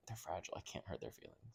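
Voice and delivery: soft, breathy whisper; whispered aside. Retold or imitated. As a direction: whisper it gently. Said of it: "but I'd also be like".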